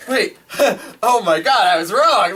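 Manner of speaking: high, loud, laughing through it; laughing mock panic. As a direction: act out a panicked realization, cracking up. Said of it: "I was like".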